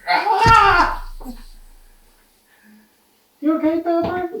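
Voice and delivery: goofy muffled sock puppet. Pretend. Puppet play.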